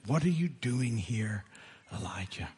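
slow, hushed, nearly breathless question; gentle whisper. Acted. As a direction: ask it softly, slow and hushed. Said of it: "a voice said to him".

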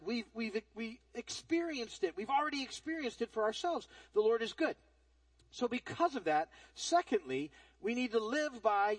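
ordinary delivery

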